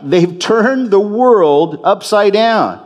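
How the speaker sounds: high, stretched-out echo of an accusation; crying out. Acted. Repeats the quoted crowd's outcry.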